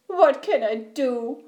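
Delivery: wailing, tearful storybook bear; whimpering. A narrator's reading. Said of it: "he whimpered".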